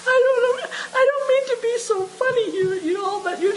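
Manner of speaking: high, laughing helplessly through the words; can barely get it out for laughing; real laughter. Real, not acted. Preacher cracking up in front of her congregation, laughing high. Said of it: "Don't make me laugh too hard".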